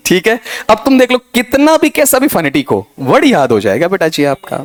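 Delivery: ordinary talk, nothing marked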